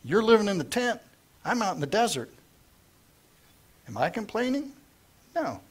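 lightly voiced aggrieved shepherd; casual mock protest; mild indignant paraphrase. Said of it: "He said".

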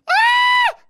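sudden high-pitched scream; high yelp of alarm; startled scream. Real, not acted. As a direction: shriek as the game goes wrong. Startled scream during game.

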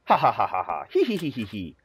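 lightly voiced ha-ha then hee-hee; a hammed-up laugh; mock cackle and giggle. Player voicing the clockmaker's laughter, mock cackle.

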